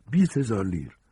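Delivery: high, firmer offer at full voice; insistent repeat. Acted. Fugitive repeating his bribe to the woman, insistent offer. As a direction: repeat it clearly so she hears. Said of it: "ساجینی تکرار کرد".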